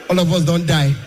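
normal talking voice

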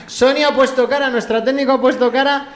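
high-pitched, laughing through the words. Real, not acted. Host laughing while telling the technician the joke. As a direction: giggle while explaining the joke.

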